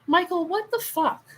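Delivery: high shocked outburst. Real, not acted. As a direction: react in disbelief. Reacting to a chat comment.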